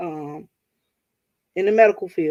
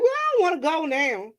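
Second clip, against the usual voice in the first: higher, whiny refusing mother; higher mock whine; mocking mom; 8 semitones higher than usual. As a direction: mimic mom digging in.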